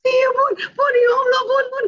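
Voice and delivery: high excited squeal, near tears of joy; a child squealing with happiness at being understood; overjoyed child. Acted. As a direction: squeal with delight like a happy teen. Teen reacting overjoyed.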